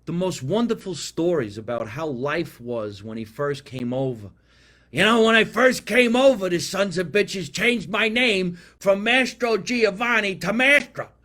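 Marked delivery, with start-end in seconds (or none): 4.9-11.1 put-on loud, high old Italian immigrant uncle retelling his story; higher and louder, outraged old immigrant storyteller; Uncle Tommy. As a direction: belt it out like the old uncle ranting about Ellis Island. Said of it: "he would tell you stories".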